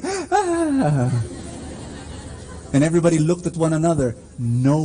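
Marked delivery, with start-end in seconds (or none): 0.0-1.1 high startled group gasp; crowd gasp. Retold or imitated. Preacher voicing the crowd, a high startled gasp. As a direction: gasp as the crowd sees the stunt. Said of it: "everyone who's cheering".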